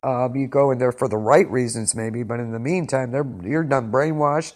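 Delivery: normal talking voice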